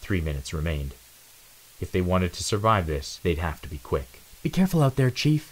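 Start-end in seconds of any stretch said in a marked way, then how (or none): 4.4-5.5 higher, concerned teammate voice; a worried warning to the chief; Magenta's line. Acted. Magenta warns Zero before he steps out, higher voice. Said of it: "Magenta said".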